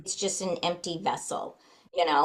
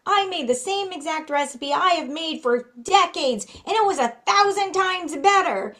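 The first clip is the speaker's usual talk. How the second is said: put-on much higher and louder, a riled-up old Irishman; indignant old Irish trainer, pitched way up; mad little Irish guy; 11 semitones higher, 5 dB louder than usual. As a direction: bark it out like a cranky Irish horse trainer. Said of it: "like, mad, you know, and he had a little temper".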